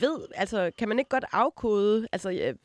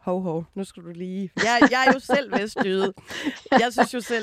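loud, amused objection; playful protest; 5 dB louder than usual. Real, not acted. Host from West Jutland objects to the expert, playfully protesting. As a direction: jump in with mock offence.

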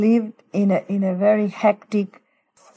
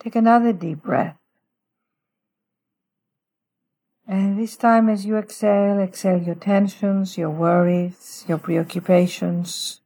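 guided meditation. Shown as a demonstration. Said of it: "let me just walk through a little prayer with our listeners".

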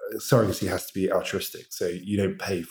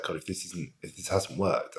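much softer, choked-up inner thought; getting emotional; 7 dB quieter than usual. Real, not acted. Husband recalling the surrogate's call, emotional and soft. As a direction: hold back tears as you remember. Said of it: "I'm even getting emotional".

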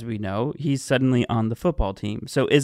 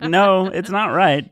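slow, higher, louder mock reply; retold firm correction, raised and slow; emphatic retort; 6 semitones higher, 6 dB louder, slower than usual. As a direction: draw out an emphatic imagined no. Retold firm answer to David.

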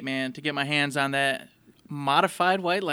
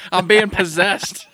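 laughing; 4 semitones higher, 7 dB louder, slower than usual. Real, not acted. Laughing joke about possession.

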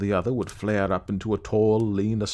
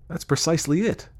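higher-pitched, quick agreement; eager agreement; 8 semitones higher than usual. Acted. Clayton hearing his elusive idea put into words, eagerly agreeing. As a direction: seize on the point eagerly.

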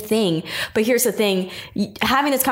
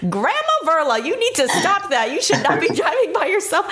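higher, laughing mock scold; 9 semitones higher than usual. Friend scolding elderly grandma for driving alone, playful laughing scold. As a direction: wag a finger at grandma, laughing. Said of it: "I'm like".

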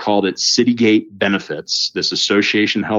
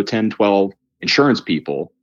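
ordinary talk, nothing marked

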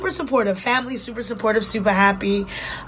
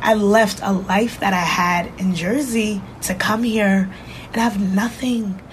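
usual speaking voice

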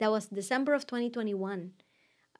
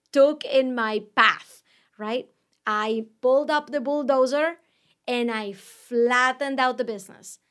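louder, charged declaration; fired-up loud; 8 dB louder than usual. Real, not acted. Owner recounting cutting her business expenses, loud and fired up. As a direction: push it out like driving a bulldozer.

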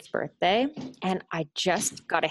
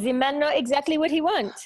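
imitated French boyfriend, pitched up; French accent; 7 semitones higher than usual. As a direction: replay his line in a French accent. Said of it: "He says".

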